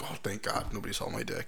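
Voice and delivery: very soft sigh of relief; low-volume retold thankfulness; hushed relief. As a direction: say it under your breath, relieved. Retelling relief at finding his clothes.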